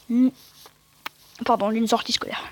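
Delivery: ordinary delivery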